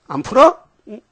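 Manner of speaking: high pushy challenge, a mock scold. Preacher mock-scolds the unforgiving servant, high pushy challenge. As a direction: needle the stubborn servant like a scolding master.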